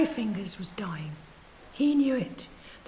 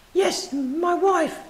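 put-on old man; 8 semitones higher, 8 dB louder, much slower than usual. Dying old man answers.

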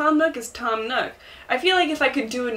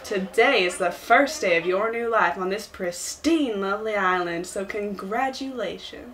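Tom Nook. Acted. Greeting new islanders on their first day, Southern accent. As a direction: ease through it like a Southern host. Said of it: "Tom Nook is now my Southern".

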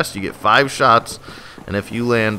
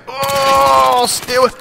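alarmed yelping; 13 semitones higher than usual. Acted. Player fends off an attacker, high alarmed cries. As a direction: cry out, pitched way up. Enemy attacks him in game.

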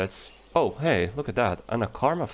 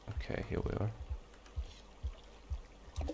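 hushed whisper; 12 dB quieter, much faster than usual. Real, not acted. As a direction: breathe it out quietly. Muttering while moving through level.

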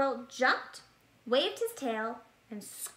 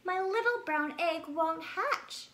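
tired and grumpy; 6 semitones higher than usual. Acted. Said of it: "feeling very tired and grumpy".

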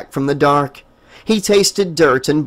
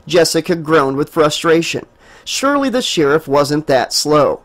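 usual speaking voice

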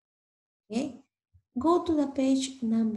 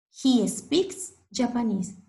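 ordinary talk, nothing marked

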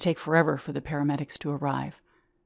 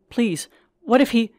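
desperate plea; 8 semitones higher, 6 dB louder than usual. Acted. Wife pleading with paramedic.

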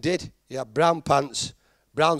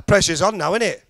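ordinary delivery

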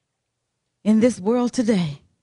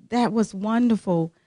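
ordinary talk

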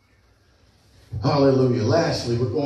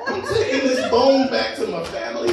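laughing through the words; laughing bird; 8 semitones higher than usual. Retold or imitated. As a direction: cackle it out as the gloating bird. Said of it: "the bird's probably laughing like".

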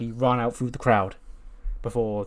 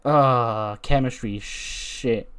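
ordinary talk, nothing marked

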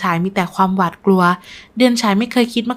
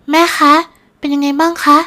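eager, higher and louder daughter; excited; 6 semitones higher, 4 dB louder than usual. A narrator's reading. Hopeful daughter asking mother for news, excited. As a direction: call out eagerly, pitched up. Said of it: "ดารารายถามด้วยน้ำเสียงตื่นเต้น".

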